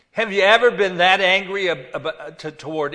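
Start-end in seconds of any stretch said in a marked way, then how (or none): 0.1-1.7 loud, raised-pitch challenge; forceful preaching. Real, not acted. Preacher challenging the congregation about anger, forceful. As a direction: fire the question out, loud and high.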